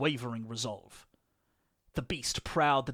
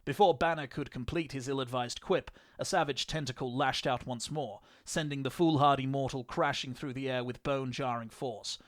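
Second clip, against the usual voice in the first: usual speaking voice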